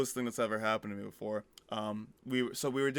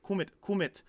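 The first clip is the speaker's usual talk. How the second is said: put-on baby voice; 8 semitones higher than usual. Baby saying come in German.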